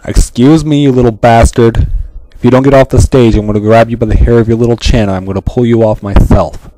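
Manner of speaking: loud furious threats; scolding shout at the intruder; angry shouting. Acted. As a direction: yell him off the stage. Yelling at intruder to leave.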